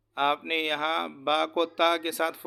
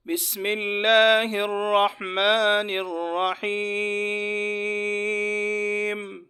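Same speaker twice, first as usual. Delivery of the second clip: slow melodic tajweed chant, higher and louder, long held vowels; fluent Quran recitation; 5 semitones higher, 4 dB louder, much slower than usual. Shown as a demonstration. Teacher reciting the surah fluently for students to follow, melodic chant. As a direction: intone each verse slowly with long pauses between. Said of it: "ابھی ہم رواں پڑھتے ہیں".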